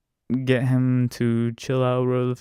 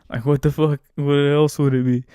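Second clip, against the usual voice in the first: louder, exasperated, in disbelief; exasperated outburst; 2 semitones higher, 6 dB louder than usual. Retold or imitated. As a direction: blow up at how obvious it is. Exasperated inner rant peaks.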